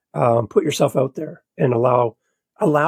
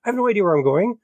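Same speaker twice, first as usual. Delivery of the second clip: panicked; 6 semitones higher than usual. Retold or imitated. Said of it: "I'm like".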